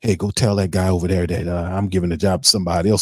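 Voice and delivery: low, hushed, hurried mock of a timid coach. Mocking the head coach sending an assistant, low and hushed. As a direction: mumble it like a coach dodging confrontation. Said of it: "'cause you know you're not confrontational".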